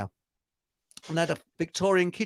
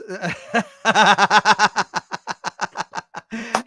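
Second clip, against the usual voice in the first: long loud helpless laugh; big real laugh; 4 semitones higher, 5 dB louder than usual. Real, not acted. Host cracking up at his own joke, loud laugh. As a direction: laugh hard at the punchline.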